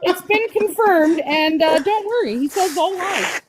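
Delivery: high excited friend voice; imitating Blue. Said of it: "Blue's like".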